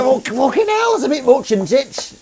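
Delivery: high, loud mock outrage at the price; high pitched loud disbelief; indignant outburst. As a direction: blurt it out, scandalised. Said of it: "I was like".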